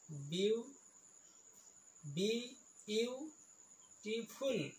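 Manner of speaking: "Beautiful" slow and broken into syllables, softly. Shown as a demonstration. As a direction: sound out "beautiful" piece by piece. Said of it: "ইয়াত তিনিটা চিলেবুল আছে". ("Beautiful" ভাঙি ভাঙি লাহে লাহে)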